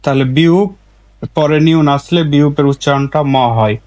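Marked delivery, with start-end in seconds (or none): none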